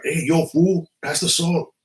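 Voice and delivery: mock homeboy at the dinner table; imitating a little gangbanger. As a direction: mimic the boy's tough-guy slang. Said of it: "this is how he talks to me".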